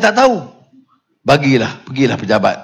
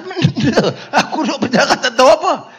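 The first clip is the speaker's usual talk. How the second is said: laughing; 8 semitones higher, faster than usual. Retold or imitated. Retelling own puzzled reply, laughing.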